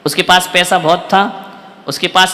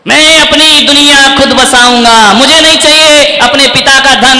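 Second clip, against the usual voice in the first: loud, raised, defiant declaration; preaching at full force; 5 semitones higher, 7 dB louder than usual. Real, not acted. Preacher voicing refusal of father's wealth, full force. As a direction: declaim it as a vow to the hall.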